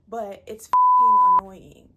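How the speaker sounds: shrieking in pure irritation; exasperated yell. Real, not acted. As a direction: blow up at how annoying it is. Ranting about couples on show.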